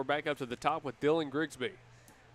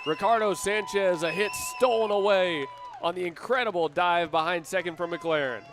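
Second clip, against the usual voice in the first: higher and louder, easing down toward the end; excited play-by-play; 5 semitones higher, 6 dB louder than usual. Real, not acted. Announcer praising McLaren's dive to listeners, excited then settling. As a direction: still buzzing from the catch. Announcer reacting to the stolen hit.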